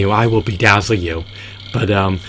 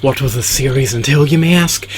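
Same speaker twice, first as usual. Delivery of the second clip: punched opening, high rising rhetorical question; show announcer; 4 semitones higher, 4 dB louder than usual. Acted. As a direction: announce it grandly, lift "you may ask" high.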